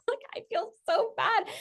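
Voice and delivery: high, soft tearful squeak; half-laughing, high weepy whine; mock weepy. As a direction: play up being sorry in a high, small voice. Still mock-weepy about her baby.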